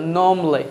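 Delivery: normally in two beats, "nom-lee"; silent R. Shown as a demonstration. Teacher answering the silent-R question, "nom-lee". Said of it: "silent হলে কি হবে?".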